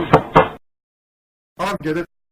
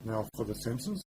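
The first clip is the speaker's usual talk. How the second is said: hushed sneaking. Acted. Sneaking past the guards.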